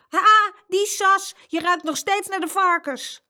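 slow drawn-out gloat at your sister; gloating tease. Acted. Jeroen taunts Saskia, gloating high voice. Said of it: "Hij begon te grinniken".

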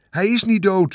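high-pitched, urgent shout; narrated shout, pitched well up. A narrator's reading. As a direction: shout the denial back. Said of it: "schreeuwde meneer Hartsuiker".

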